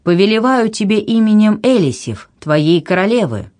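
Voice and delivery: high, imperious voice; commanding. A narrator's reading. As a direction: command in the queen's name. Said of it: "властно повторила Анна".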